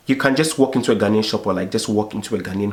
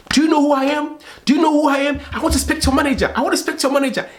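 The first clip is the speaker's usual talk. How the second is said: entitled customer demanding; faster than usual. Retold or imitated. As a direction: play the indignant traveller demanding the manager. Entitled Ghanaian demanding at airport.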